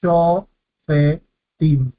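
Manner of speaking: SHOFETIM very slowly, sho, fe, tim; split in three syllables. Shown as a demonstration. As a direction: break "Shofetim" into three long, separate syllables. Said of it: "dividimos en tres".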